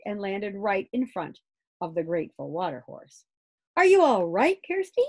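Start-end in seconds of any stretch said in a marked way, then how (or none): 3.8-5.0 high, loud anxious call; worried shout. Acted. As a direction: call out in alarm. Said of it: "everyone shouted".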